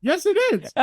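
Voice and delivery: very high-pitched comic retort; mock protest. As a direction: argue back in a squeaky-high mock protest. Patient acts out arguing back.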